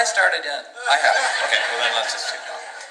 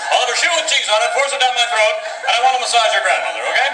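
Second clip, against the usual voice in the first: eager order; 10 semitones higher, 4 dB louder, faster than usual. Acted. Oblivious tourist ordering cheerfully.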